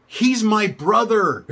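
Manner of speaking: put-on kid's loud declaration. Little kid telling the teen ringleader the truth, loud and slow. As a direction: announce it proudly and loudly as a little kid.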